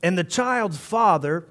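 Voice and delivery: usual speaking voice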